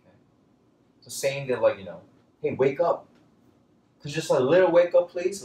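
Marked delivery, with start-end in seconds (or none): 2.4-5.4 high, loud urgent plea; waking someone up. Acted. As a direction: plead louder and higher to rouse someone. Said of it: "tried to wake this guy up".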